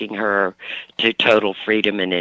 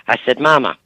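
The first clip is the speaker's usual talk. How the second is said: ordinary talk, nothing marked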